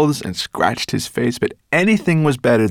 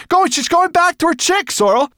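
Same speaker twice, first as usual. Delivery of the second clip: high, loud, urgent call; excited cry; 4 dB louder than usual. A narrator's reading. Ben telling Sorrel the bird is leaving, excited cry. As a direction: shout the news up high to a friend. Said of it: "cried Ben".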